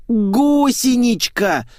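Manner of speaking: high, drawn-out whine; whining. A narrator's reading. Blonde tries to explain pet names, whining. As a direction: stretch the word into a long whine. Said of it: "заныла Светик".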